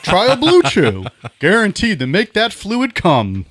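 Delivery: loud, high mock commercial pitch; ad announcer. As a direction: sell it like a cheesy ad. Mock ad read.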